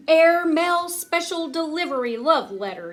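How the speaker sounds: high, loud flying shout; gleeful storybook yell. Acted. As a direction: holler it while sailing through the air. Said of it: "yelled Grover".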